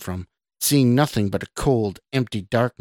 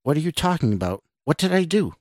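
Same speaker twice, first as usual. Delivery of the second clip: higher, quick bewildered questions; alarmed confusion; 6 semitones higher, faster than usual. A narrator's reading. Scott questions the accusing woman, alarmed and confused. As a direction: demand an answer, pitch climbing. Baffled man pleads for an answer.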